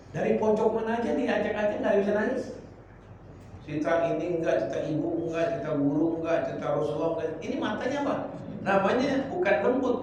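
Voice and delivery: normal talking voice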